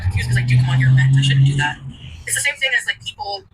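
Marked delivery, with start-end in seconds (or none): 0.0-1.8 loud admission. Real, not acted. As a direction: own up to it loudly. Confessing venting on stream.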